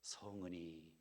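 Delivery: bow low with the words of a palace servant; courtly thanks. Retold or imitated. Pastor jokingly offering the grandest thanks, slow court style.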